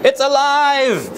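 high, slow triumphant cry; mad scientist. Pretend. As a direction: shout it like Frankenstein. Frankenstein line, higher take.

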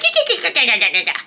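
rapid cackling "kikikaka" giggle; stuttering mock giggle trailing into breathless laughter; imitated giggling. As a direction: act out a helpless giggle fit. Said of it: "we're just giggling".

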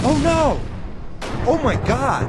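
high shrill panic; panicked shriek. Acted. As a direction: cry out in alarm. Panic at bad news.

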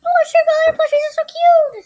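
high, slow cooing squeal; squealing over cute. Real, not acted. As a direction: melt over something adorable. Gushing over a cute item.

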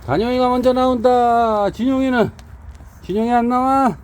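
high loud coaxing call. Real, not acted. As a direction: call to the dogs to come out. Calling dogs out.

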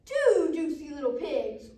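loud, drawn-out wolf boast; booming greedy wolf; wolf's cry. Acted. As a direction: cry it out big, relishing it slowly. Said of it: "Wolf cried".

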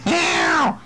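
high, falling meow; cat meow. Acted. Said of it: "What's that cat doing?".

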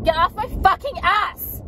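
high-pitched slow angry yell; angry yell at driver. Real, not acted. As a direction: snap it at the tailgater, high and drawn out. Yelling at a tailgating driver.

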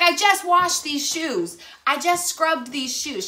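slow, high complaining; whiny daughter. Retold or imitated. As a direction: drag out the whine. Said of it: "she's like".

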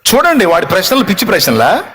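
ordinary talk

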